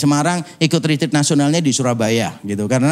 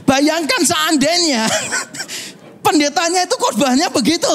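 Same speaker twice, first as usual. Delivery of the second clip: laughing; 11 semitones higher than usual. Real, not acted. Laughing at the pastor's sermon.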